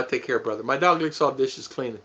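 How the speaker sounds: ordinary talk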